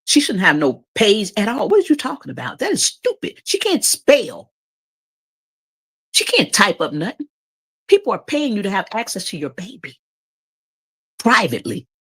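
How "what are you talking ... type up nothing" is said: high disbelieving scoff; incredulous. Real, not acted. Outraged at baby account.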